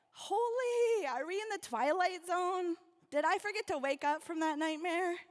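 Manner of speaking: disbelief. Retold or imitated. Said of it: "I was like".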